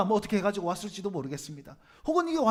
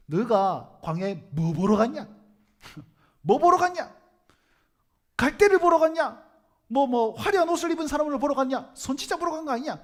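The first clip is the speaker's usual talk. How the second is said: imitated angry scolding, raised and loud; angry rebuke; 3 semitones higher, 5 dB louder than usual. Preacher voicing Jesus to the crowds, angry scolding. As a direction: act out an outburst at the crowd, question after question. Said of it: "이러면서 막 화를 내기 시작하는 거예요".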